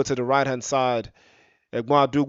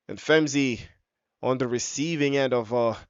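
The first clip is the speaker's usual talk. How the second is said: ordinary delivery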